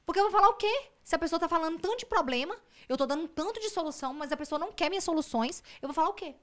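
high-pitched, exasperated argument; exasperated retort. Retold or imitated. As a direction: argue back at someone who rejects every solution. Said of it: "Eu falei assim". (desabafo exasperado, em voz aguda)

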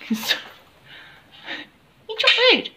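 squeaky high coax to a pet; high sing-song to the bird; pet talk. Real, not acted. Coaxing pet to eat.

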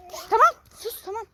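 high, loud shushing commands; firm loud hush. Real, not acted. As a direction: hold the dog back with your voice. Restraining the lunging dog.

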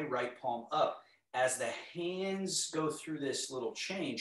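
calm, unhurried movement cues; serene tai chi cues. Acted. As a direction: guide the class gently through each move.

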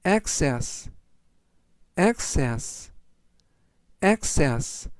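access read slowly, with a falling end; slow word reading. Shown as a demonstration. Teacher reviewing vocabulary with learners, slowly. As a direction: say access slowly as a model to copy. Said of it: "제가 천천히 세 번씩 읽어드리고요".

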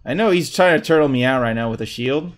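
ordinary delivery

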